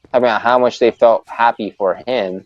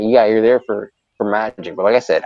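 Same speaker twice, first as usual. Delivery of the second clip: ordinary delivery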